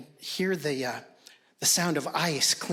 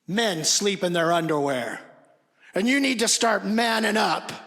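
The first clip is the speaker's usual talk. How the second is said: firm scolding building to a loud shout; angry lecture; 4 semitones higher, 5 dB louder than usual. Retold or imitated. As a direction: start stern, end up yelling at him. Dad scolding about pajamas.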